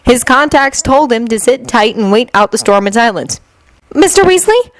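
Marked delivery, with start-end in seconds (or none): 3.9-4.7 clerk calling out. A narrator's reading. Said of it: "called a clerk".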